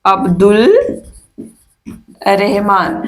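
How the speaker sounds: each word set apart with long pauses; dictating word by word. Shown as a demonstration. Teacher to students, dictating Abdul and Rahman word by word. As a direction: spell out Abdul then Rahman slowly for notes. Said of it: "name split for writing".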